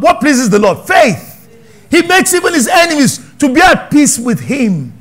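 loud, high, driving sermon cry; preaching at full force. Acted. Preacher shouting the verse's meaning to his congregation, fervent.

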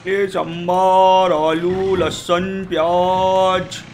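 loud, slow, drawn-out street call; vegetable seller's cry. Shown as a demonstration. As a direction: hawk vegetables, stretching each name long. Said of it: "फिर अपन आलू टिंडे भी बेचते हैं". (सब्ज़ीवाले की लंबी पुकार)

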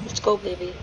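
usual speaking voice

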